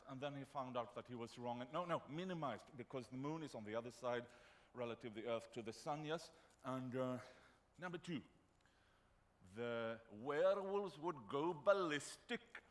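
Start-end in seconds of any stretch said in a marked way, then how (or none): 1.7-12.6 excited student. Retold or imitated. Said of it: "He said".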